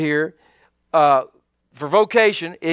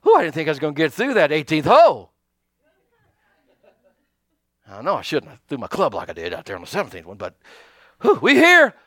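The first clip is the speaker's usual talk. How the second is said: mock breathless latecomer; 3 semitones lower than usual. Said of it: "you know, having".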